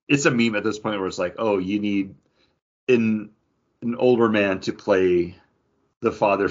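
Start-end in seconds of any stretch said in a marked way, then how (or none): none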